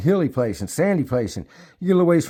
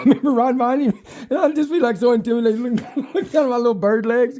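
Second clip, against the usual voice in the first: laughing while talking; 11 semitones higher, 3 dB louder than usual. Real, not acted. Laughing at his own story.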